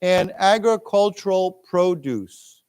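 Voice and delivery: slow, clear phrase for repeating; slow model reading. Shown as a demonstration. Teacher models phrase for students to echo, slow. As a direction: read it out slowly, syllable by syllable. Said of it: "let's read this".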